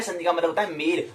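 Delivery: usual speaking voice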